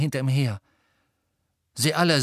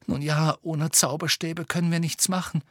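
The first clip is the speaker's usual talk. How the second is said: higher, helpless resignation; hopeless; 6 semitones higher than usual. A narrator's reading. Hermine without a wand answering Harry, hopeless. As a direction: say it as if giving up. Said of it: "sagte Hermine hoffnungslos".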